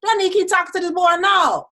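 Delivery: high, loud and fast; excited. Retold or imitated. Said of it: "I was like".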